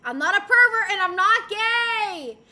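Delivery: loud denial. Real, not acted. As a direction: burst out with it, high and loud. Mantra bursts into loud denial.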